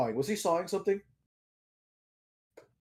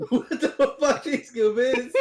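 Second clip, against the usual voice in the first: laughing; 7 semitones higher, 3 dB louder, much slower than usual. Real, not acted. Still laughing, asks again.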